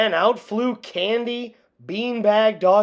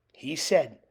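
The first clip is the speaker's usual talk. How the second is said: usual speaking voice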